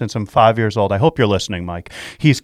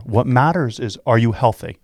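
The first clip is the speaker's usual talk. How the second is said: ordinary talk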